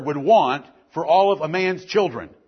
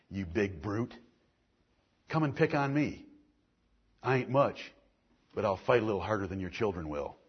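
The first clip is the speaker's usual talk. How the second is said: much softer, lower, intimate sermon voice; quiet earnest preaching; 8 semitones lower, 10 dB quieter than usual. Real, not acted. Pastor quietly rebuking the men who hurt children. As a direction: lean in and press it softly.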